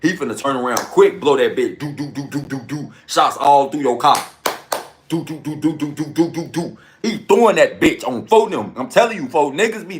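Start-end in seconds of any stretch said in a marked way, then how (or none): 1.8-2.9 soft, rapid do-do-do shots; mouth gunfire. Retold or imitated. Imitating shots at a car. 5.1-6.8 soft, rapid do-do-do shots; mouth gunfire. Retold or imitated. Imitating shots again.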